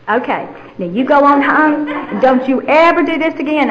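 scolding policeman. Retold or imitated. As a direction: play the officer letting her off with a warning. Acts the officer letting her go.

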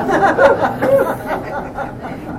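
a high, squealing laugh of embarrassment; giggling at being found out; high embarrassed laugh. Real, not acted.

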